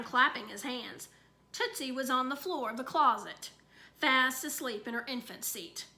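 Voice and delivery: ordinary delivery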